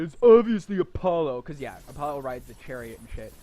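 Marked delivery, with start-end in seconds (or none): 0.0-1.4 loud, higher-pitched mock objection; raised mocking voice; imagined know-it-all viewers. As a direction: imitate viewers insisting on the obvious. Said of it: "you are probably thinking".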